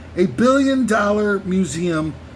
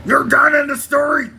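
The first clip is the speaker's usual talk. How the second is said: Nick Nolte impression; 5 semitones higher, 3 dB louder than usual. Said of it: "It's Nick Nolte from the movie Forty-eight Hours".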